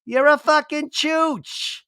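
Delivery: put-on very high, slow kid voice; little girl's voice. Repeating the kid's curse for a ringtone, very high and slow. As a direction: squeak it like a toddler swearing. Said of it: "I want that as my ringtone".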